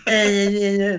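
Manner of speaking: high, whining mock president; mocking childish whine; Trump. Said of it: "He's like".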